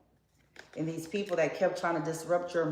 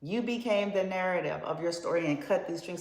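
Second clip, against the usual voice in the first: usual speaking voice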